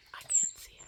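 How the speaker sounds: hushed whisper so the bird stays. Real, not acted. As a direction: breathe it out without voice. Watching a bird up close.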